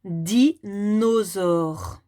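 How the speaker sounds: "Dinosaure" slowly stretched, di... nosaure; découpage en syllabes. Shown as a demonstration. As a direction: draw out "Dinosaure" slowly for a child to hear each sound. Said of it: "les petits carrés pour le mot". (« Dinosaure » articulé lentement, di… nosaure)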